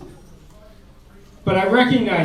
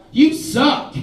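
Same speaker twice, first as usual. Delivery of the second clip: higher, mocking woman's voice; mock scornful woman; 6 semitones higher, slower than usual. Woman mocking his pickup line, higher and scornful. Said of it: "she responded with".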